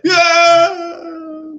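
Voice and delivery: very high, long drawn-out scream; loud falling yell; distant scream. Acted. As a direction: wail like someone being thrown. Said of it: "you hear him, like".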